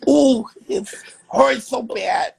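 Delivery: high, slow pained whine; hammed-up wail of a hurt boxer; mock crying in pain. Imitating the beaten fighter for laughs, mock crying in pain. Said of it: "He was crying".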